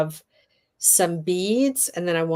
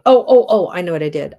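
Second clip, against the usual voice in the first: usual speaking voice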